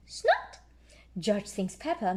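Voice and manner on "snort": high squeaky snort; pig snort. A narrator's reading. George snorts.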